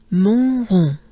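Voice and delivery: rond with a breathy throat R on the exhale; French R. Shown as a demonstration. Teacher demonstrating the French R loudly. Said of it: "big breath".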